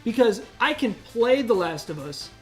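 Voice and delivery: ordinary delivery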